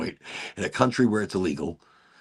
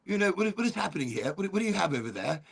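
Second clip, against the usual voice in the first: puzzled British guy; 5 semitones higher, 3 dB louder, faster than usual. Retold or imitated. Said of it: "this guy's like".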